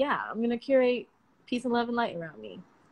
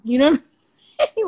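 laughing; 4 semitones higher, 9 dB louder, much faster than usual. Real, not acted. Laughing at her own joke.

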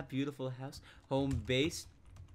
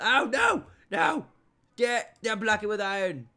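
loud, high alarm; startled high-pitched cry into frantic commands; panicked shouting; 8 semitones higher, 10 dB louder than usual. Real, not acted. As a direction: yell urgent orders in a sudden panic. Sudden lava emergency in game.

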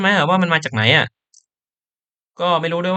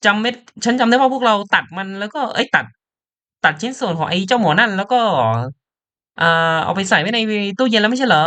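higher, urgent hushing; alarmed character voice; 7 semitones higher than usual. Acted. Two characters argue in high voices. (เสียงสูงรีบห้ามไม่ให้พูดดัง)

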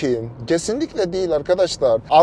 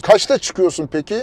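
ordinary talk, nothing marked

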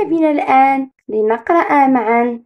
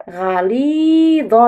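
غليظ drawn out very slowly with a clear ظ; slow modelled word; much slower than usual. Shown as a demonstration. Teacher models the word slowly for the class to repeat. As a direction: stretch غليظ out for little ears. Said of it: "رددوا".

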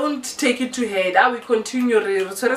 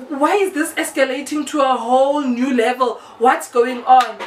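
ordinary delivery, nothing marked

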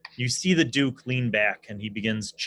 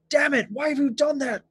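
high, louder, hurt and indignant king; high pained outrage; 6 dB louder than usual. Acted. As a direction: squeal it out in pain and outrage. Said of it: "He's like".

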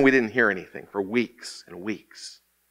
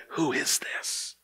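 awed whisper; 4 semitones higher, 9 dB quieter, slower than usual. Acted. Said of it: "cry out like the disciples did".

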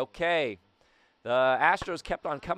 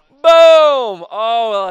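high, loud exclamation; big celebratory holler; exultant boom; 8 semitones higher, 16 dB louder, much slower than usual. Real, not acted. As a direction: boom it out like the bat hit. Relives home run on replay.

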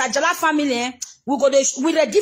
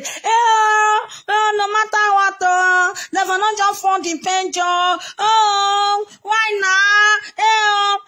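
wailing lament; 3 semitones higher, much slower than usual. Real, not acted. Lamenting to her followers, wailing and crying. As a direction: wail it out in sung cries.

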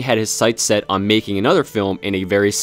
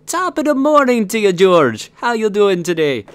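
high Irish lilt sliding into Jamaican; hammed-up Irish greeting going off track; botched Irish accent; 10 semitones higher, 3 dB louder than usual. Greeting co-host George in a botched Irish accent. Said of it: "started off like he's going to Irish".

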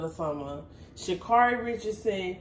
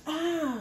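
higher, drawn-out mock "ah" of pain; fake hurt cry; 7 semitones higher, 4 dB quieter than usual. Showing the runner how to fake injury, mock pained. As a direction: let out a badly faked hurt cry. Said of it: "you shoulda been like".

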